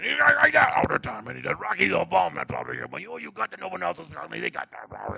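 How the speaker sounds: loud, high garble out of half the mouth; garbled manager Mick. Retold or imitated. Preacher imitating Rocky's manager, loud slurred side-mouth rant. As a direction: yell unintelligibly from one side of the mouth. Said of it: "talks only out of half of his mouth".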